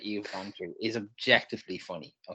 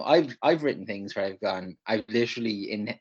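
ordinary delivery

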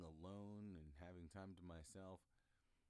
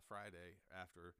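ordinary talk

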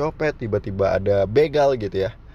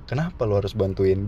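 ordinary delivery

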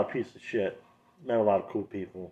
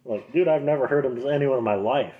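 ordinary talk, nothing marked

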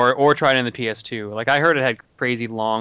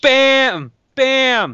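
excited shout; 13 semitones higher, 7 dB louder than usual. Real, not acted. Excited shout after a pun.

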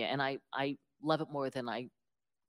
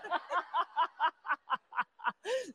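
high laughter; 13 semitones higher than usual. Real, not acted. Laughing at own story.

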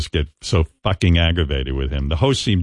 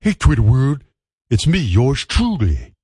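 louder, higher put-on O J voice; imitating O J's sign-on; 4 semitones higher, 3 dB louder than usual. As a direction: mimic his famous greeting. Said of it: "There's his famous ... sign on".

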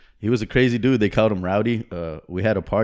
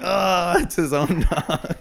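high, slow, stretched-out mock yelp; mock surprised yelp; 8 semitones higher, 3 dB louder, much slower than usual. Friend bitten by his pit bull, high slow mock yelp. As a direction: imitate a guy startled by his own dog's bite. Dog clamps onto owner's arm.

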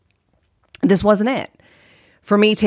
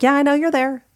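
higher-pitched, quick acknowledgment; placating reply; 6 semitones higher, faster than usual. Retold or imitated. Podcaster answers her inner critic, higher and placating. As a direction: humour the nagging voice. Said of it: "I hear her and I'm like".

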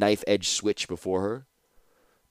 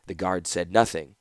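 ordinary delivery, nothing marked

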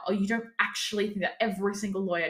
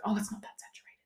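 soft, breathy mocking whisper of the crowd; a hushed mock of everyone's sudden denial; mocking aside; 2 semitones higher, 7 dB quieter, faster than usual. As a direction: toss it off under your breath, making fun of them. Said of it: "everyone's like".

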